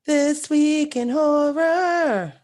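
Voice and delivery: slow, high and loud, like a horror show host; announcer voice. Pretend. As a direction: stretch it out like a spooky show intro. Dramatic segment title announcement.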